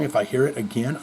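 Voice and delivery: ordinary talk, nothing marked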